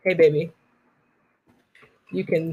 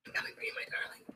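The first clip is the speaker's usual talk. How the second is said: whisper; 23 dB quieter, faster than usual. Pretend. Sneaking up on the date.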